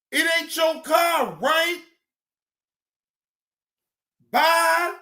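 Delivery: high, loud taunting yells; mocking shout. Real, not acted. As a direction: yell them off the screen. Mocking yells at bodycam footage.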